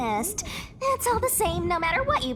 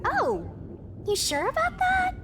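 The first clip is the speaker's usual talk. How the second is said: high, mocking disbelief; sly high-pitched you sure about that; teasing doubt; 11 semitones higher than usual. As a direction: needle the player about their choice.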